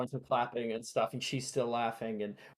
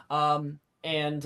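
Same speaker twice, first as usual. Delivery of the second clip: ordinary delivery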